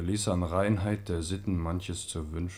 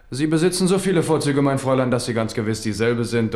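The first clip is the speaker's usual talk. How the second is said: higher and louder, courtly enthusiasm; gallant flattery; 5 semitones higher, 7 dB louder than usual. Acted. King gallantly flattering the young lady, higher and louder. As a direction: charm her with delight.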